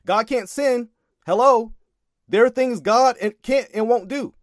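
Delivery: ordinary talk, nothing marked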